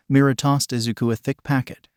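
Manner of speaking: ordinary delivery, nothing marked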